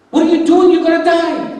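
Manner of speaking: high, loud alarmed yell; frantic calling out. Retold or imitated. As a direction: shout through a wall to stop someone. Man behind wall calls out.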